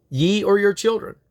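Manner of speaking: ordinary talk, nothing marked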